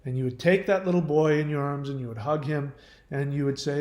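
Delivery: normal talking voice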